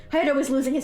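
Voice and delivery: ordinary delivery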